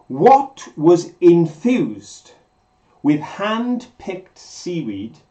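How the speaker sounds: slow, deliberate quiz delivery with pauses; measured quiz host, key words drawn out; quizmaster reading a question. Real, not acted. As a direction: spell out the question phrase by phrase.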